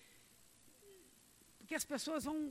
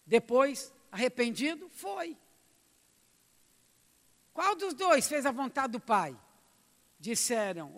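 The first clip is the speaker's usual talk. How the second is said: loud, measured Bible reading to the church; scripture reading; 7 dB louder, slower than usual. Acted. Preacher reading the parable aloud to the church, solemn and loud. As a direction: read the verses with weight.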